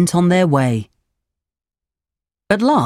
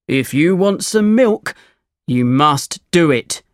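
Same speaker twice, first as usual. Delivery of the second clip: lightly voiced firm farmer; farmer, insistent. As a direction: insist as a farmer setting terms.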